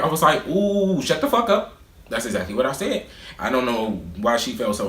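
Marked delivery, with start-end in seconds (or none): 0.5-1.7 high, dismissive ooh then the brush-off; sassy dismissal. Retold or imitated. Cutting off the teacher mid-lecture, sassy and dismissive. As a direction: wave her off with sass.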